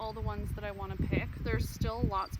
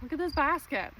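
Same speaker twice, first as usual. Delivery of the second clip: excited; 5 semitones higher, 5 dB louder than usual. Real, not acted. Excited at harvest.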